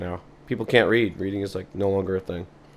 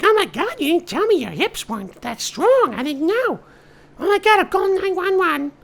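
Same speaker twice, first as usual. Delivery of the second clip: very high, louder panic; frantic, about to call for help; panicking old woman; 5 dB louder than usual. Acted. Date panicking at the injured old man, very high.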